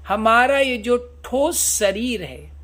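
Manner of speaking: ordinary delivery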